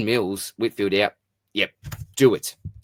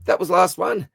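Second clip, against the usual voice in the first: high, loud and pleased; excited announcement; 10 semitones higher, 5 dB louder than usual. Real, not acted. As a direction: call it out like a finish line. Announcing end of mailbag.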